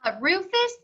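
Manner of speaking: plain reading voice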